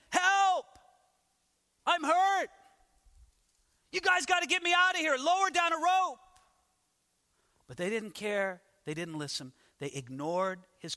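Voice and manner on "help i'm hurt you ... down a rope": much higher, louder mock yell for help; screaming for help. Joseph in the pit calling up to his brothers, high yelling. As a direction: shout up out of the pit, hurt and scared. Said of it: "He's screaming".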